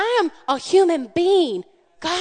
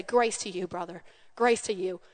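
gentle reply; 7 semitones lower, 8 dB quieter than usual. Retold or imitated. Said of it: "I heard my husband say".

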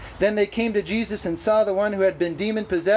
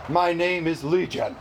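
slightly lowered, plain-spoken demon; low demon voice; 3 semitones lower than usual. Acted. Preacher voicing the unclean spirit naming itself, low. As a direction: answer as a demon on the low side. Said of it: "They're either high or low".

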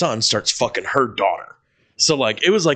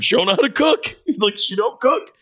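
much higher, pitiful little voice; mock kid voice; 12 semitones higher than usual. Imitating the coworker's son about his mom's cooking. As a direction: squeak it out sadly.

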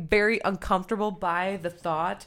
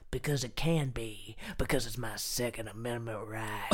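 low, put-on gun-nut voice; mock gun advocate; 7 semitones lower, 5 dB quieter than usual. Answering co-host's question as a gun defender, mock low voice.

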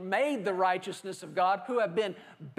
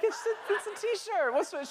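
much higher, squeaky disbelief; mock indignant protest; 10 semitones higher than usual. Husband objects to wife rejecting his t-shirt, much higher pitched. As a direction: whine it like a wounded husband. Preacher protests wife's verdict.

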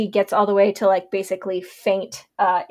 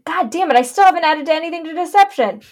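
frustrated; 8 semitones higher, 6 dB louder than usual. Real, not acted. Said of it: "I keep doing it to coercion".

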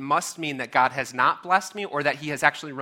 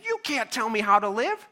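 defiant outburst; 8 semitones higher, 6 dB louder than usual. Acted. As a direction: shake a tiny fist and demand freedom. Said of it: "shook his little fin at God and said".

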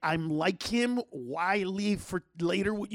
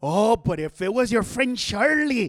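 mocking dad; 5 dB louder than usual. Dad shaming son by praising his friend Charlie. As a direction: gush sarcastically about the friend. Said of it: "he was like".

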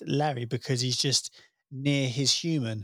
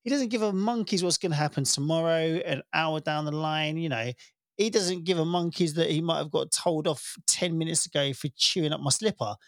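normal talking voice

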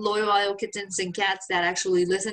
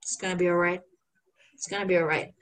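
lower, calm reassurance; calm the worried family; gently imitating reassuring them; mock reassuring; 5 semitones lower, faster than usual. Calming his people.

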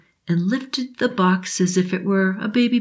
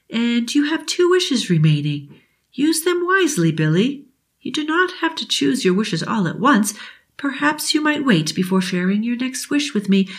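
put-on kind old genie; 6 semitones higher than usual. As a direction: promise it like a fairy godmother. Genie speaks.

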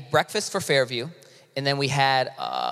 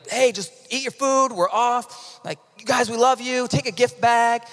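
higher-pitched, upbeat welcoming voice; eager welcome, re-enacted; 6 semitones higher than usual. Volunteers welcoming teachers to lunch, re-enacted eager hospitality. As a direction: play the eager host handing things out.

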